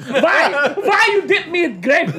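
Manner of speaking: high, loud mock-Russian accent, laughing; outraged cartoon Russian accent, cracking up; dubious Russian accent. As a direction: ham up a muddled Russian-German accent. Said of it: "Is that a Russian accent?".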